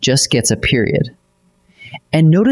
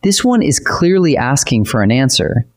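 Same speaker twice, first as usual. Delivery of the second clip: normal talking voice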